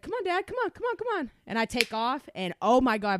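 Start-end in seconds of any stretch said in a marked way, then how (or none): 0.0-1.3 high, teasing "come on" to the dad; high teasing egging-on. Retold or imitated. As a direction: egg him on, pitched up. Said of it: "I said".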